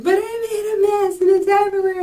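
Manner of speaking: high-pitched whine, hammed-up distress; mock emotional wail. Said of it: "And I'm emotional".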